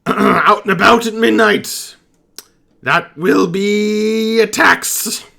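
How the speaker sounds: high, loud goblin voice, slowing to a self-important end; goblin captain. Acted. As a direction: deliver a pompous goblin's verdict.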